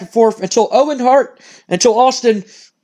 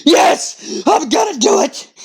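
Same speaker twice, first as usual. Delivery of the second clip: high manic voice, breathy yes then a giddy shout; unhinged wrestler deciding to grab the chair; crazed wrestler; 6 semitones higher, slower than usual. Retold or imitated. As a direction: play a man who just snapped. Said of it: "he goes".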